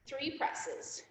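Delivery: winded, almost voiceless cue; breathless whisper. Real, not acted. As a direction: gasp it out under your breath. Cue called while out of breath.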